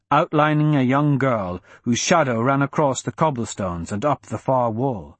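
usual speaking voice

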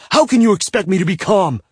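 high, loud outraged yell; angry yell. A narrator's reading. Bound man to his captor, outraged yell. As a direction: shout the question at her. Captive yelling back.

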